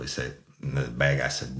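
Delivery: normal talking voice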